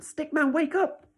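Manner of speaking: high, loud wake-up call; shout. Shown as a demonstration. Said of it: "Can you shout at your screen now".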